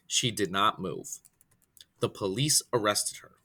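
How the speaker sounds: ordinary delivery, nothing marked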